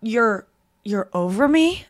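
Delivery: slow, drawn-out disbelieving question; mock dazed Ross; stunned Ross. Ross realizing Rachel's message, slow and stunned. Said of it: "he goes".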